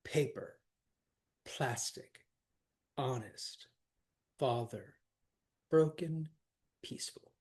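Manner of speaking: "paper, plastic, honest, father, broken, peaceful", each stressed on its first syllable; trochees. Shown as a demonstration. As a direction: list them one by one with pauses, PA-per, PLAS-tic, falling away. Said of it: "stressed first, then unstressed".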